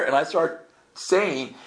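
ordinary delivery

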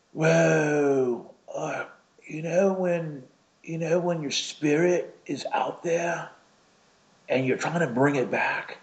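high-pitched imitation British accent. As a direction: imitate a British man marvelling. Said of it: "I mean, my British accent".

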